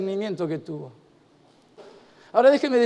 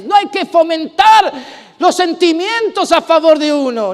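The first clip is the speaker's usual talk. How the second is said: loud, driving sermon voice; preaching at full force; 6 semitones higher, 8 dB louder than usual. Acted. Pastor preaching loud to the congregation about applause. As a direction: preach it out to the whole room.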